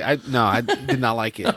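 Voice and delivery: normal talking voice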